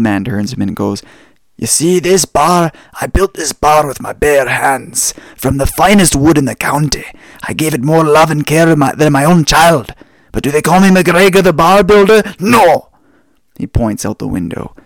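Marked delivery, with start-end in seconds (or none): 1.6-12.9 put-on loud, higher old Scottish barman brogue; Scottish accent. Old Scot boasting to a young tourist, loud Scottish accent. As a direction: bellow it like a proud old Highland Scot. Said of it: "the old Scottish guy".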